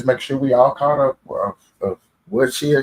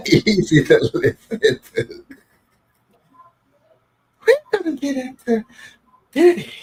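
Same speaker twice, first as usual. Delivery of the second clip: imitating soft voice; 14 semitones higher than usual. Co-host teasing the narrator's soft voice, high imitation. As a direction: put on a high, dainty presenter voice. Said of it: "such a soft voice".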